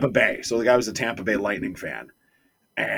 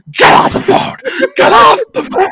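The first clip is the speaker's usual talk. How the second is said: screaming much higher and louder; furious shout; 16 dB louder than usual. Retold or imitated. Radio co-host screaming at a caller, furious high shout. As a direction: blow up at the caller. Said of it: "He's like".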